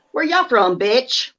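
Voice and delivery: sweet Southern voice turning sassy; southern accent. Retold or imitated. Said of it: "I have to accentuate my accent".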